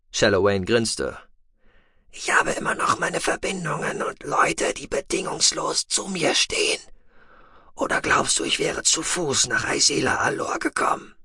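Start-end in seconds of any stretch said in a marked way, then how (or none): 2.1-11.1 a breathy, half-whispered alien voice; Shallowayne's voice. A narrator's reading. Shallowayne telling Tech it is too late, in a hushed alien whisper. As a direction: hold him in a hushed, husky whisper.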